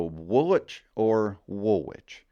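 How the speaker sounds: slow Woolwich and Woolwich set side by side; both accepted forms; two ways. Shown as a demonstration. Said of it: "two acceptable ways".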